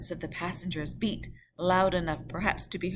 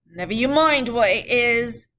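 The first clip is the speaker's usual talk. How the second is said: loud, higher retort; 6 semitones higher, 11 dB louder than usual. Acted. Guard retorting to the rider, loud. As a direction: snap it back. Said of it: "the guard retorted".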